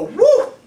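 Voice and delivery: a sudden high, loud whoop; triggered yell. Retold or imitated. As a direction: burst out with a high, loud "Woo!" as if provoked. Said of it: "and I will go".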